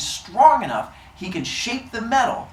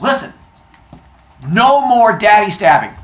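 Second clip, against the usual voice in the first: scolding dad; 6 semitones higher, 8 dB louder than usual. Acted. Dad scolding his sons.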